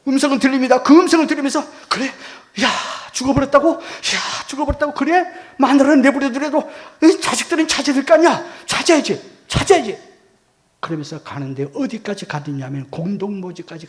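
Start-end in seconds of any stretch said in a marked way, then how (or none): 1.9-10.0 high, loud, disbelieving protest; agitated outcry. Retold or imitated. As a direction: act out an urgent, worked-up outcry. Acting out his shocked outcry. (흥분해서 높고 크게 따지듯)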